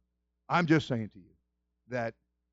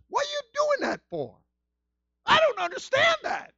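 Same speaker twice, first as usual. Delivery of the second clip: very high, squealed complaint; mock protest; 4 dB louder than usual. Lump of clay complaining to its maker, mock protest. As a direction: protest in an absurdly high, hammed-up voice.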